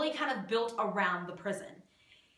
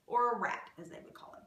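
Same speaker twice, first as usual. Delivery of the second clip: whispered aside; 4 dB quieter, faster than usual. Acted. Whispered aside about the rat.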